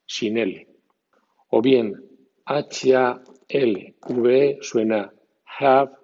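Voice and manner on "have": "have" dicho hav, alto y fuerte; English "halve". Shown as a demonstration. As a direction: say hav with no L, punched up. (di "have" sin L, enfatizado)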